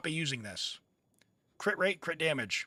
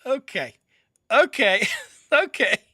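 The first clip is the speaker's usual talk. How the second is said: repeated okay, getting louder and higher; exasperated okay; 5 semitones higher, 12 dB louder than usual. Real, not acted. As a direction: build to a high, loud burst of exasperation. Reacting to own failed light command.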